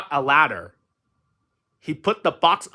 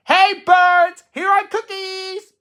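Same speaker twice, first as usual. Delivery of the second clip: high, loud call outdoors; loud shout; 8 dB louder, slower than usual. A narrator's reading. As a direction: call the birds from afar. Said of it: "shouted in a loud voice".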